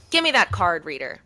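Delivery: fed up after hours at the car lot; fed-up demand. Retold or imitated. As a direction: snap it out, done waiting. Fed up at the dealership.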